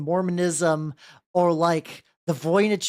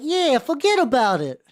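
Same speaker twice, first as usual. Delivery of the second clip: higher-pitched, put-on Brooklyn accent; 7 semitones higher than usual. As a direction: brush it off like a cartoon Brooklynite. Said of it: "the way they did Osaka was Brooklyn".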